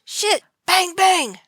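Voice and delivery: loud high panicked shouts; shouting guard. A narrator's reading. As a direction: bark orders in a panic. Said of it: "guard shouted".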